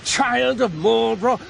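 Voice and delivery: heated rant, pressing each point; indignant tirade. Acted. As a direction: build in outrage at being questioned. Indignant tirade at Mister Prince.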